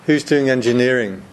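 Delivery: ordinary talk, nothing marked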